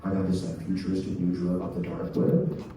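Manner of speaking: low secretive pitch to a raver; shady dealer. Retold or imitated. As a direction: sell it like a sketchy guy at a rave. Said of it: "And I'll go up to them and be like".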